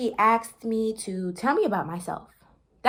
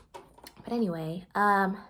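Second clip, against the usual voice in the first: ordinary delivery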